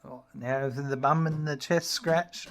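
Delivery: ordinary talk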